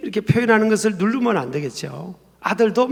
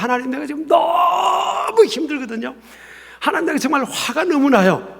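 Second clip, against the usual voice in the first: anguished complaint; 7 semitones higher than usual. Acted. Believer telling God how hard it is, anguished complaint. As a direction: pour it out to God, acted and strained high. Acts out venting feelings in prayer.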